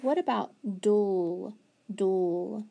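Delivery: "dull" said slow and clear; dictating a word. Shown as a demonstration. Teacher dictating a spelling word to children. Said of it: "on number five".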